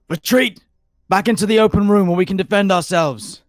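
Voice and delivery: high, very loud battle shout; shouted command. Acted. Player shouts to the party to retreat, loud and high. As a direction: yell orders across the fight, drawing them out. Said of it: "I just shout".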